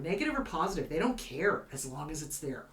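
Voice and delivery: ordinary delivery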